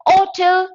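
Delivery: "hotel" starting on O, no H; wrongly silent H. Shown as a demonstration. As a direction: leave the H off "hotel". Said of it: "You cannot say hotel".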